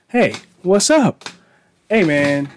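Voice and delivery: ordinary delivery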